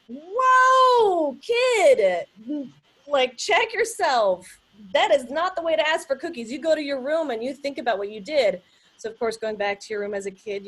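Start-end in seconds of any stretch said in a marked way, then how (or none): 0.1-8.6 loud, higher mock-stern parent; hammed-up parent telling the kid off; scolding parent. Parent scolding child for yelling about cookies, mock-stern. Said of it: "my mom or dad would say".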